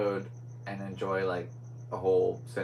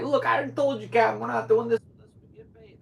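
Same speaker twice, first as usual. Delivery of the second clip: exaggerated Bronx accent, much higher and louder; a brash Bronx assistant, pitched way up and pushed loud; 14 semitones higher, 7 dB louder than usual. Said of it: "a lady from the Bronx".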